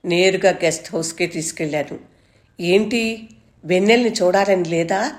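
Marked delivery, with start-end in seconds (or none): none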